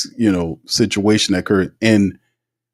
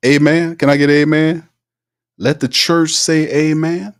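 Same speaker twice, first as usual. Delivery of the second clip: preacher call-and-response; 6 semitones higher than usual. Acted. Calling on the congregation.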